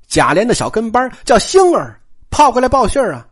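ordinary delivery, nothing marked

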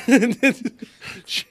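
usual speaking voice

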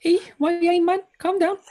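high-pitched put-on Geordie; mock Geordie voice on request; Geordie accent. Shown as a demonstration. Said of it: "I feel like I could do Geordie".

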